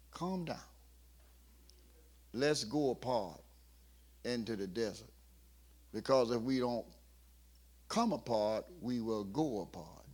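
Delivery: soft, low, settling voice; calming Jesus. Acted. Jesus calming excited apostles, soft and soothing. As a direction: hush them gently. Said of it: "tell them to calm down".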